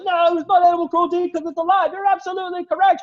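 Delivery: high, loud crowd bickering; squawky back-and-forth from the stands; arguing spectators. Acted. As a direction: argue back in a high put-on shout. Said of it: "and it's just like".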